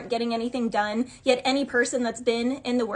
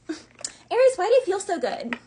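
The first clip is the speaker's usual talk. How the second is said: mock indignant question; 6 semitones higher than usual. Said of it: "people out here are like".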